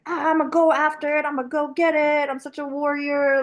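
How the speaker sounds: high, pumped-up go-getter voice; warrior mode. Retold or imitated. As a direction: hype yourself up like a fighter. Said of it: "in, like, this warrior mode".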